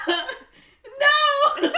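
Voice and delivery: very high, loud mock wail; hammed-up heroine's cry; melodramatic shout. Woman betrayed to the police, mock horrified shout.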